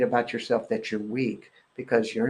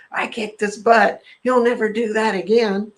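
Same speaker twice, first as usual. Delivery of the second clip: mock boast, higher and louder; boastful father; 10 semitones higher, 7 dB louder than usual. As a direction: crow about it like the proud dad. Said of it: "that's what my father said".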